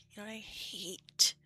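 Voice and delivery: slow breathy whispered question. Real, not acted. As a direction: whisper it slowly.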